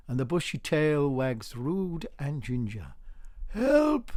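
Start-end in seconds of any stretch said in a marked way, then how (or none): 3.5-4.1 high, loud cry; cry for help. A narrator's reading. Organist crying out for help in his dream, high and loud. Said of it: "cries Organ Morgan".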